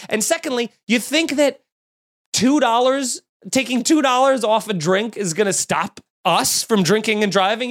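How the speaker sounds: usual speaking voice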